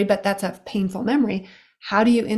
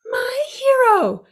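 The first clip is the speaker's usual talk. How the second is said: very high, slow, swooning sigh; swooning damsel; slower than usual. Pretend. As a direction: gush it like a rescued damsel. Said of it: "this is the world's shortest play".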